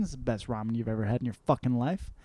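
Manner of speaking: usual speaking voice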